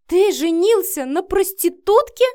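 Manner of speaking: loud, high, scandalised wail; shrieking. A narrator's reading. Mother learning of her son's marriage, shrieking. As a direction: shriek the question in outrage. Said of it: "завопила женщина".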